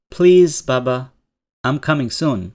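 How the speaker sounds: a coaxing, pleading request. Acted. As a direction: beg sweetly like a daughter coaxing her father. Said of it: "খুব আবদারি সুরে বলছে".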